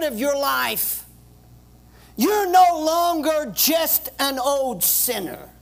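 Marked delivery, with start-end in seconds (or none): none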